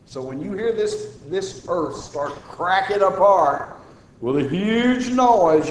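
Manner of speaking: normal talking voice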